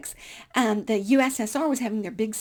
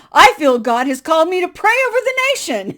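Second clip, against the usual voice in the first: mock zealot; 8 semitones higher, 13 dB louder than usual. Mocking her own zealous reason.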